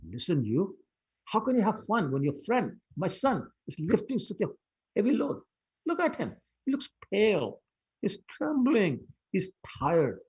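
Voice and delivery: worried mother pointing at her trembling son; anxious mother. Acted. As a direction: fret over your son, pitch climbing. Worried mother scolding Madhumangal.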